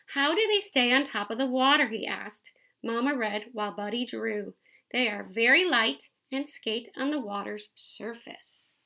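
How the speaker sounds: lively picture-book read-aloud; reading to children. A narrator's reading. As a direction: read it out to the kids, bright and clear.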